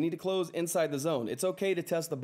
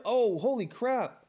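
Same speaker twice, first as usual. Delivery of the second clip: amazed; 7 semitones higher, much slower than usual. Real, not acted. Another big donation arrives.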